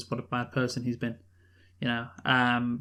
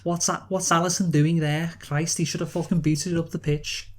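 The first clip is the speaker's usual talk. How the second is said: Jamie Carragher impression; 3 semitones higher than usual. Imitating Carragher commentating Liverpool v Man City in a Scouse accent. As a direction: mimic a Scouse pundit moaning at a goalkeeper's mistake. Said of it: "So he goes".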